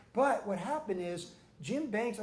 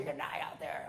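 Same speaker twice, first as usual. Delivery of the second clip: imitating Roy; 4 semitones lower, 7 dB quieter than usual. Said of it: "He always told me".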